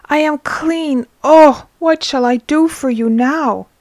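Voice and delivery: high, loud joyful cry; joyful shout. A narrator's reading. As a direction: shout for joy. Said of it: "He shouts".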